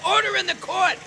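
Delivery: very high, hammed-up judge; judge's mock gavel cry. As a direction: squeal order like a cartoon judge. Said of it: "the judge said".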